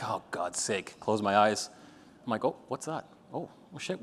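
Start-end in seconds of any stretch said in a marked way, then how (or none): 0.0-1.7 muttered exasperation. Retold or imitated. Retold exasperation at the exercise. 2.4-4.0 hushed surprise. Retold or imitated. Retold surprise as emotion hits.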